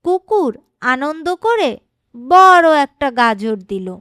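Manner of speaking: ordinary delivery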